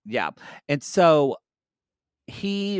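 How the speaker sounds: ordinary talk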